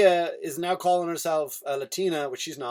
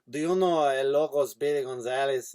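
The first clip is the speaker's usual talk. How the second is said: put-on cartoon Mexican accent; Speedy Gonzalez; 3 semitones lower than usual. Comedian telling an old cartoon joke, Speedy Gonzalez accent. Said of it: "doing an impression of, of Speedy Gonzalez".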